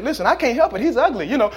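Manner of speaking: cheeky excuse. Acted. Bird excuses itself.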